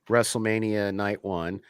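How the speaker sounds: normal talking voice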